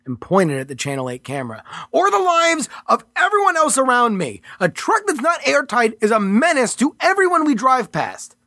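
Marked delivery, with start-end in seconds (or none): 1.9-8.4 raised, tense protest; Mitchell's character voice. Acted. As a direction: argue back, higher and pressed. Mitchell arguing with agent.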